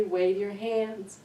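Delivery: ordinary delivery, nothing marked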